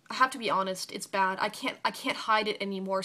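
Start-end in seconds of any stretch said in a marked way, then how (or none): none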